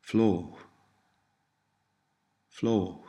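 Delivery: Fló with long o and an H after it; long o, final H. Shown as a demonstration. Tutor models Cornish for learners, Fló ending in an H sound. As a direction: end Fló on a breath of H. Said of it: "the H sound can follow a vowel".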